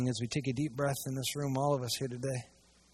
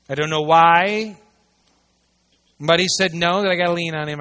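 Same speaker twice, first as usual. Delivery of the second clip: mock complaining churchgoer; 9 dB louder than usual. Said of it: "that goes".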